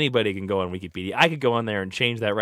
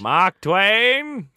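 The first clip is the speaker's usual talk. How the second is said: higher, hammed-up British accent; mock British accent; 7 semitones higher, 4 dB louder than usual. Repeating the joke to co-host, hammed-up British accent. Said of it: "Why is he British?".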